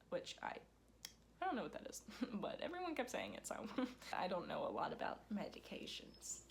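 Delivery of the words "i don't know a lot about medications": confiding whisper to the audience; whispered aside. Real, not acted. Vlogger whispers her confession to viewers, hushed. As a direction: drop to a secret whisper.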